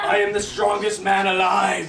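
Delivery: booming mock strongman shout; hammed-up mighty hero bellow. Dad playing superhero with kids.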